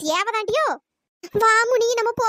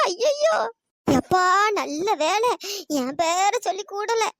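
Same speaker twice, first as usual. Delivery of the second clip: ordinary talk, nothing marked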